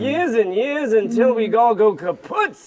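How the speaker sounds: put-on tired, complaining old camel voice; grumpy old camel. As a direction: moan about aching back and feet. Camel grumbles about the desert.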